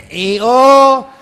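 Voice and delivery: loud high hee-haw bray; donkey bray. Pretend. Donkey stuck in the well, loud high bray. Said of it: "to do donkey sounds".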